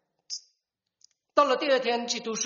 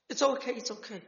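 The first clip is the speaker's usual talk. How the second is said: gentle comforting, slightly higher and falling; reassuring; 4 semitones higher than usual. Retold or imitated. Sister hugging her brother, gently reassuring him. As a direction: soothe a sorry little brother.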